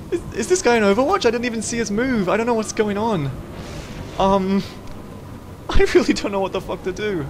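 high, anxious rambling; terrified gibbering. Real, not acted. Continued panic, high pitch.

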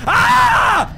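sudden high scream of fright; sharp shriek, caught off guard; startled scream. Real, not acted. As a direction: yelp as it jumps out. Startled by the monster close.

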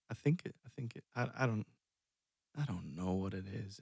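ordinary delivery

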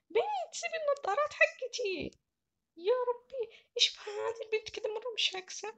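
put-on a girl whining as her hair is pulled; pestered young carer. Carer annoyed by the child.

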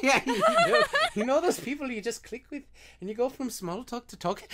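high mock Irish through laughter; attempted Irish accent. Shown as a demonstration. Struggling through the fan email, laughing Irish accent attempt. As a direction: attempt Irish while cracking up. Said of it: "Let me try, let me try".

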